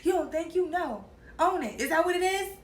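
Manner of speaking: high, loud mock yell of an angry father; retold screaming dad. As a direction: imitate dad shouting at his daughter. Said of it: "he just started screaming at me".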